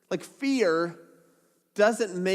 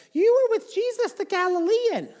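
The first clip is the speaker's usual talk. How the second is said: put-on much higher girlish voice, pointing him out; servant girl voice; 5 dB louder than usual. Servant girl accuses Peter, high girlish voice. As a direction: put on a high young girl's voice.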